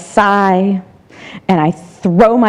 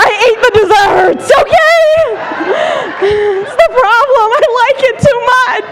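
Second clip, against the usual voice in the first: inner critic voice; 12 semitones higher, faster than usual. Acted. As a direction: whine it high and quick. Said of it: "She's like".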